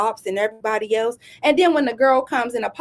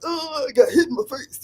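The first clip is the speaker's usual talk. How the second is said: mock whining. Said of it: "that little picture of you like".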